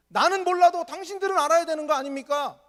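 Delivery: shouted challenge. Retold or imitated. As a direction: fire the question back hard. 맹인의 외치는 반문. (높고 크게 따지듯 외치며)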